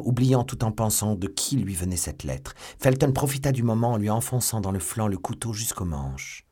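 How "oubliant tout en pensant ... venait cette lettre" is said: ordinary delivery, nothing marked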